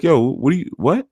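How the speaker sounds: high mock-incredulous yo; a high mock-offended challenge; retold disbelief. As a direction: ask it sky-high in mock shock. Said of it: "I was like".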